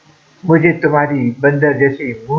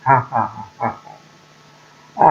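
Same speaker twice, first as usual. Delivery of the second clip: put-on light childish giggling; innocent giggle; 2 semitones lower, faster than usual. A narrator's reading. Girl giggles on, ignoring the thorn, innocently. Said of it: "मसावा की मासूम हंसी".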